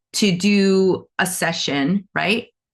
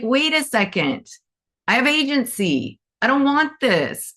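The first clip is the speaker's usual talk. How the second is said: firm refusal; 7 semitones higher than usual. Retold or imitated. Karen refusing.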